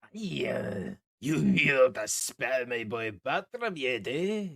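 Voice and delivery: slow, put-on halfling voice; lightly voiced tipsy halfling; halfling NPC voice. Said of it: "He goes".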